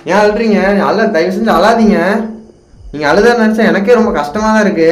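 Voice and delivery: loudly begging the crying ghost to stop; loud pleading. Real, not acted.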